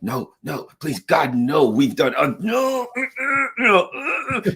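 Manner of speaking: high, whimpering mock panic; squeaky hammed-up panic; panicked whimper. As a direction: plead like a terrified aide. Blinken panicking, acted by S1.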